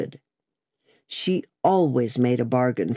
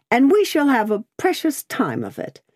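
high-pitched grumbling character voice; acted grumble, pitched well up; Miss Pross; 10 semitones higher than usual. A narrator's reading. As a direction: complain in a raised, emphatic voice. Miss Pross grumbles about shopping.

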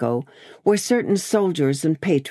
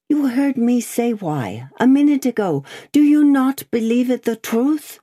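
high, insistent prisoner voice, growing louder; protesting prisoner; 9 semitones higher, 4 dB louder than usual. Acted. Prisoner insists he told the truth, earnest protest. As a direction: push back, pitch raised well up.

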